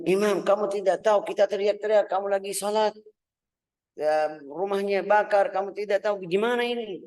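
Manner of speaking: high, loud exasperated questioning; agitated reproach. Retold or imitated. Household reproaching the imam after the fire, high and loud. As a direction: press him in disbelief.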